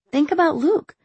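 high, pleading and urgent; desperate. A narrator's reading. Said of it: "she said desperately".

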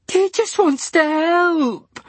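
very high, slow wail. A narrator's reading. Diana wailing at Dylan. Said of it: "wailed Diana".

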